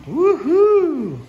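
high, loud woohoo; excited cheer. Real, not acted. End-of-video cheer.

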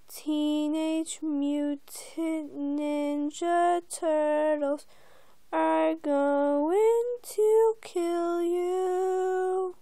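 very slow, drawn-out eerie chant; creepy title-card voice. Acted. Narrator reads the altered TV intro, slow and menacing. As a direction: stretch every word into a menacing announcement.

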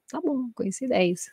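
normal talking voice

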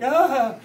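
mock the injured lady's cry; imitated moan. Crash victim answering the driver, mock pained cry. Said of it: "She's like".

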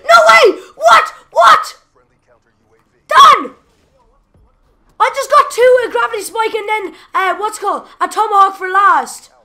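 high, loud ecstatic shrieking; shrieking jackpot winner. Real, not acted. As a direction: shriek like you hit the jackpot. Big multi-kill, shrieking in joy.

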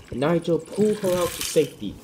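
plain reading voice

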